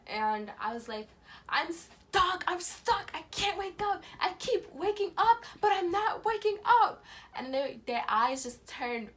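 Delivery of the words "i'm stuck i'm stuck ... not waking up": high, loud acted desperation; panicked cry. Trapped dreamer crying out to parents at the door, panicked. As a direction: cry out in panic, building louder. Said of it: "I started screaming for my parents".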